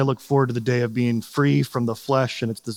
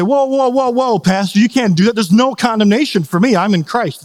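higher-pitched mock protest; hammed-up defensive objection, pitched up; objecting churchgoer; 6 semitones higher, 4 dB louder than usual. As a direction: cut in like someone fending off the pastor. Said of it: "And they would say".

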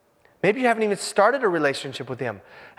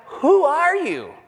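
indignant question; 8 semitones higher, much slower than usual. Retold or imitated.

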